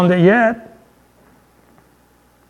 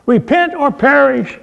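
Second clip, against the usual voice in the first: higher mock shout of a street preacher; a hint of ranting at the crowd; imitated street preacher yell; 8 semitones higher than usual. Street preacher at a theatre crowd, mild mock yell. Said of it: "yelling and shouting at the crowd".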